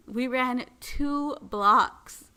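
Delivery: gasping, high and loud, dragged out slowly; out of breath. Retold or imitated. Owner retelling chasing her dog, gasping breathless. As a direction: heave out the words like you just sprinted. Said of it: "I'm very out of shape".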